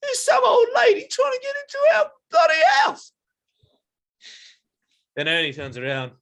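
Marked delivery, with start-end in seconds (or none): 0.0-3.1 very high, loud outraged gorilla shout; squealing, indignant character yell; angry gorilla. Acted. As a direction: shriek the alarm in a put-on gorilla voice. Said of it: "they're quite angry".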